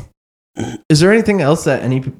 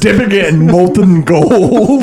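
laughing; 5 semitones higher, 3 dB louder, slower than usual. Pretend. Playing clueless chocobo owner.

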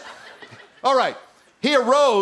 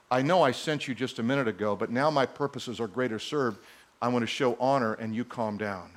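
low, very soft calming voice; soft Jesus to the wind; 8 semitones lower, 7 dB quieter than usual. Acted. Jesus speaking to the wind, low and very soft. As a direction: hush the storm gently.

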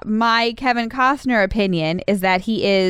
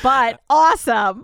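mock stubborn catchphrase; 3 semitones higher than usual. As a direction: toss off "But awesome" as a blanket defense. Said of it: "consistently come back with".

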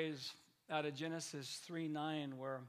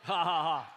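louder hearty staged laugh; extra mock laugh; 11 dB louder than usual. Preacher asks for an extra laugh and gives it loudly. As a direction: give an extra big laugh on cue. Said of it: "give an extra laugh on that one".

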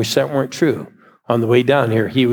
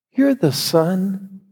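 higher, earnest voice that halts and repeats itself; old preacher; 9 semitones higher than usual. Retold or imitated. An old preacher tells a boy he is God's son, high and earnest. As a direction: sound like an old man staring into a boy's face.

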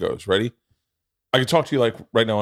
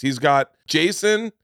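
ordinary talk, nothing marked

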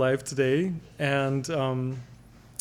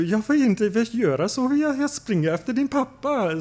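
voicing mom; 8 semitones higher than usual. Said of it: "my mom was like".

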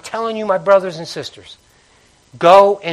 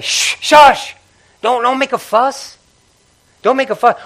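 higher scolding hush; hushing crowd; 7 semitones higher, 4 dB louder than usual. Retold or imitated. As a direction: shush him like an annoyed crowd. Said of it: "what the crowd saying".

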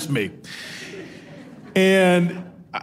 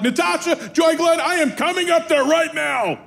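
high-pitched dad at boiling point; imitated furious scream; 11 semitones higher than usual. Dad threatening his daughter from downstairs, retold angry scream. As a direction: yell the threat like a parent who's lost it. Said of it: "and I screamed at her".

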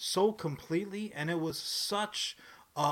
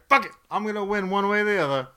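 imitating Ellis; 4 semitones higher, 9 dB louder, faster than usual. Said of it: "He's like".

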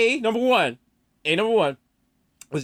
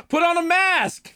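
high scolding; 10 semitones higher, slower than usual. Pretend. Scolding rapper doctor.

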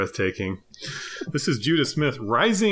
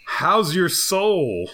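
loud, drawn-out, higher read; all-caps tweet reading; 6 semitones higher, 4 dB louder, much slower than usual. Acted.

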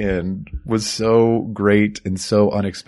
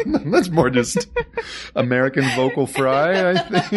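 laughing; 8 semitones higher than usual. Real, not acted. Laughing through remark.

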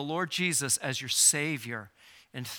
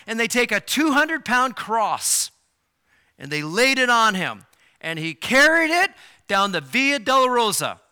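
louder, higher preacher's build through the passion; full-force preaching; 5 semitones higher, 7 dB louder than usual. Acted. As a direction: preach it, rising and pressing.

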